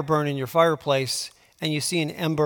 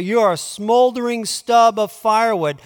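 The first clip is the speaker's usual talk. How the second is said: a touch higher and louder, mock name-calling; mock insult; 5 semitones higher, 5 dB louder than usual. Shown as a demonstration. Preacher showing his flock how to insult someone, mock scorn. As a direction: hurl it like a playful insult. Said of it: "say it this way".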